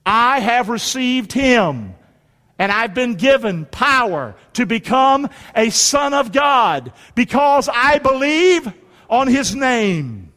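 slow, measured declaration in short phrases; congregational scripture recitation. Real, not acted. Preacher leads congregation reciting a verse, slow declaration. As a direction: lead a crowd reciting, phrase by phrase.

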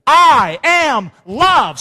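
loud, high, drawn-out affirmation; emphatic declaration. Shown as a demonstration. Preacher leading the congregation to repeat it, loud slow declaration. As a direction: proclaim it word by word, very slowly.

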